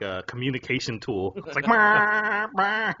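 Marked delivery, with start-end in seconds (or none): 1.6-2.9 high, long honking noise; imitated car horn. Drivers using horns to talk, imitated honking. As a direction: honk loudly with the voice. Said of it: "everybody uses their horn".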